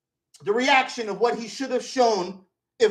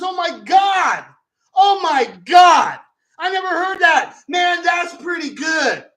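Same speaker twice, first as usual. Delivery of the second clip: higher, loud and slow, delighted; hammed-up Smokey laughing it off; amused shock; 6 semitones higher, 6 dB louder, slower than usual. Smokey reacting to the rumor, slow amused shout. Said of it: "He says".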